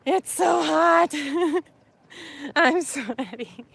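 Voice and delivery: slow, drawn-out whine with a laugh; playful, stretched-out whiny moan; whining. Real, not acted. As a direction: complain about the heat in a half-laughing whine. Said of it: "Okay, I'll stop whining".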